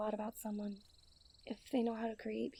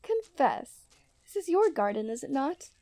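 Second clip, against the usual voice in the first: teasing challenge; 8 dB louder than usual. Acted. Princess teasingly catches him out.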